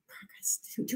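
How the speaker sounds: Progress. whispered without voice. Real, not acted. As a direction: breathe Progress. as a private whisper. Repeating the word, whispered.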